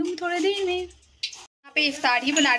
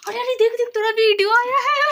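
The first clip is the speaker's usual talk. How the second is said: higher, louder excited dad voice calling her over; imitating excited dad; 9 semitones higher, 4 dB louder than usual. Dad calls daughter to see her video, excited high mock voice. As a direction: mimic dad spotting her video on YouTube. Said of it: "तो वो फट करके".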